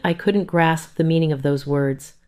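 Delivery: usual speaking voice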